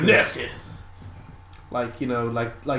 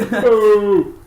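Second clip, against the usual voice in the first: mock boo; 9 semitones higher, 12 dB louder than usual. Echoing the heckler boo.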